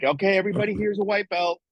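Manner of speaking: mock friendly instructor; mock gentle instructor. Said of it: "'Cause it's not like".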